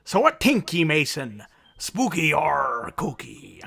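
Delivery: a lightly put-on Irish brogue that slides toward pirate; hammed-up Irish storyteller asking spooky or kooky; caricature Irish. Host asking co-host Mason spooky or kooky, mock Irish lilt. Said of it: "You were trying to do Irish".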